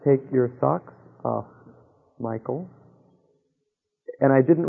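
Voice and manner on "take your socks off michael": soft and lower, slowed, a hushed inner voice; still small voice. Retold or imitated. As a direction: murmur it gently from within. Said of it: "and the voice said".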